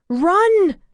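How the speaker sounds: high loud scream; woman screaming at the driver to flee. A narrator's reading. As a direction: scream the warning. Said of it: "She screamed at the man".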